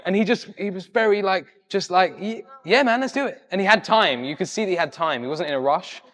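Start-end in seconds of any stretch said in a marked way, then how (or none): 2.2-3.4 high, louder easygoing yes; imitated eager agreement. Singer agreeing to a fan's video request, eager. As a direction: act out someone happily agreeing, pitch lifted.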